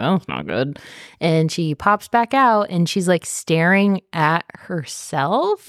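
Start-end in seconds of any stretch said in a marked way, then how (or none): 0.2-0.8 low flat understatement; deadpan low. Retold or imitated. Princess reacts in low voice.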